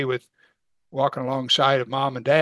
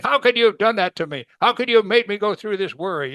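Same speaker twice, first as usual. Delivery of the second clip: higher, scolding mother's reproach; scolding parent; 6 semitones higher, 3 dB louder than usual. Pretend. Mother scolding her son, upset reproach. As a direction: scold the child for the worry. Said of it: "now you recoil and you say".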